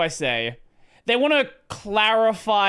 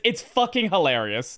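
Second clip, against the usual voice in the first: normal talking voice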